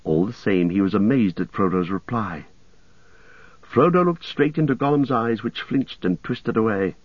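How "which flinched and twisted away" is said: usual speaking voice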